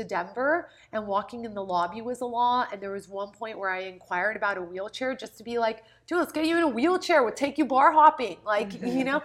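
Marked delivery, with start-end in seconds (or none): 6.1-8.4 quick, louder, higher enthusiasm; eager coaxing, louder and faster; retold upbeat pitch. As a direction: talk someone into a fun outing, brisk and loud.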